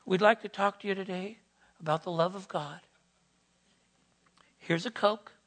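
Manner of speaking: mock mild, quick and casual pitch; soft-pedaled preaching. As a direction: play a watered-down preacher, easygoing and quick. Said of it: "He doesn't soft pedal it".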